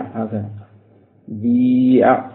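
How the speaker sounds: normal talking voice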